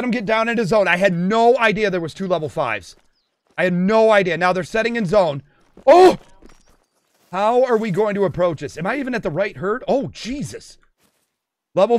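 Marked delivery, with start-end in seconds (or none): 5.9-6.2 short, high, loud exclamation; startled "oh". Real, not acted. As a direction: yelp in surprise. Startled during the hunt.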